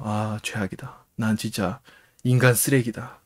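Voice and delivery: 작고 처진 목소리로 자책; 스스로에게 실망해 힘없이 중얼거리기; 자학하는 혼잣말. Retold or imitated. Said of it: "혼자 자학을 하고 있습니다". (풀 죽은 자학 흉내)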